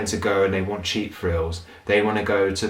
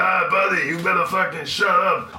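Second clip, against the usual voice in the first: higher, swaggering mock threat; mock Dutch threat; 9 semitones higher than usual. Dutch guy threatening complainers, hammed-up swagger. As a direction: play a big drunk Dutchman. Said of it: "they're like".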